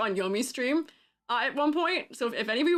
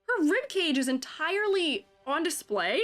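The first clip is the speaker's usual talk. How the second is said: high-pitched exasperated complaining; pitched-up, fed-up griping; frustrated rant; 7 semitones higher than usual. Real, not acted. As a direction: whine about the armor, pitch climbing up. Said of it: "I'm frustrated".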